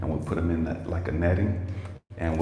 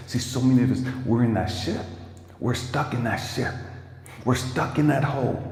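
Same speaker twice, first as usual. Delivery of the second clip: rising, impassioned sermon climax; preaching at full force; 5 semitones higher than usual. Acted. Preacher urging the congregation out of their stuck hole, impassioned. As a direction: drive the message home, pitch climbing.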